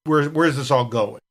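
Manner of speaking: ordinary delivery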